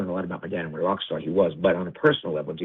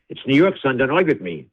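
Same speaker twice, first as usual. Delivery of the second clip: raised, higher insistent dad voice; stubbornly insist, a touch louder and higher; insistent father; 5 semitones higher, 5 dB louder than usual. Retold or imitated. Confused father insisting to his son, raised and stubborn.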